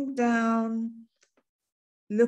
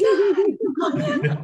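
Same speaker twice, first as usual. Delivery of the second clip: very high, loud laughing call; excited Jamaican kid; 9 semitones higher, 7 dB louder, slower than usual. Retold or imitated. Schoolkids calling others over, loud and laughing. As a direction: shout to the others in delight. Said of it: "the kids were like".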